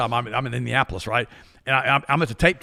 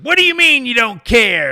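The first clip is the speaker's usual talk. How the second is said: much higher, louder, slower mock outrage; indignant lady; 9 semitones higher, 9 dB louder than usual. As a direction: play her offended disbelief. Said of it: "she looked at me and goes".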